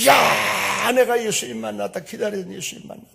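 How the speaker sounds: high, loud cry of news; joyful announcement. Retold or imitated. Woman at the well shouting she met Jesus. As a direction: tell the whole village you met Jesus. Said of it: "우물가의 여인이".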